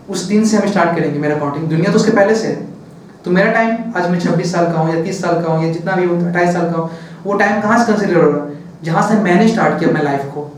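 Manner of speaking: ordinary delivery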